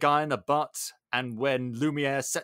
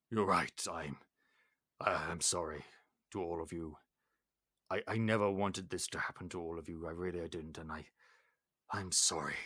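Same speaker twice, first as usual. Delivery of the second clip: low, much softer contrite voice; remorseful apology; 8 semitones lower, 9 dB quieter than usual. Retold or imitated. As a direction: apologize quietly, broken by remorse.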